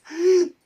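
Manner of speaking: short high burst of laughter; quick laugh. Shown as a demonstration. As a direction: flash into a laugh. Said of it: "from laughter".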